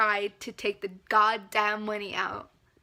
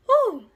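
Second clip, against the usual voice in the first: shrill exclaiming woo, much higher and louder; 13 semitones higher, 10 dB louder than usual. Real, not acted. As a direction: cry out in disbelief. Reacting to the disaster.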